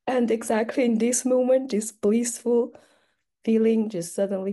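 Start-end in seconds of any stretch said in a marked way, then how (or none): none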